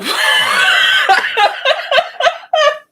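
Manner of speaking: high, loud whooping laugh; long high laughing shriek. Real, not acted. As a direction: let out a big high whoop.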